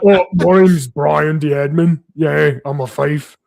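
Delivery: slow, silly voice of a dim admin; goofy idiot NPC. Retold or imitated. Game runner retelling his dumb NPC entrance, slow and goofy. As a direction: ham up a slow-witted tavern buffoon. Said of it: "I was just like".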